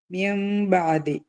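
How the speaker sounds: മിംബാദി with nun turned to m, hummed very slowly; iqlab demo. Shown as a demonstration. Teacher showing students iqlab, slow hummed chant. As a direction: hum the m in മിംബാദി long and slow. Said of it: "അതിനാണ് എന്ത് പറയുക? ഇഖ്ലാബ്".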